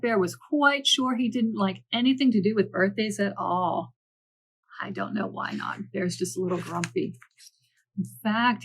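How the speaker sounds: ordinary delivery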